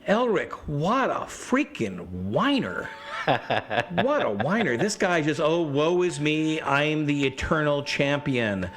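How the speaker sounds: slow, high, scornful mock of a whiner; sneering at a whiny hero, slowly; Linda mocking Elric. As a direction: draw out the scorn like you're fed up with him. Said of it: "And she'd go".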